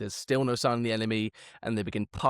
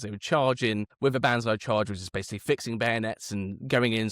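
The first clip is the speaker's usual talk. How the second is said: normal talking voice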